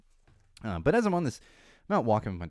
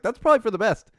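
much higher and louder mock-polite jab; sarcastic retort; 12 semitones higher, 10 dB louder than usual. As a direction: toss it after her sarcastically. Retorts as she walks off.

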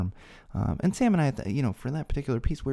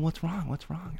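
soft, higher-pitched concerned question; gently imitating a worried friend asking; 6 semitones higher, 6 dB quieter, slower than usual. Friend to crying drummer collapsed in his arms, soft concern. Friend comforting him as he cries.

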